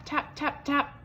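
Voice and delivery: high little tap tap; tapping sound. A narrator's reading. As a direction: tap it out lightly.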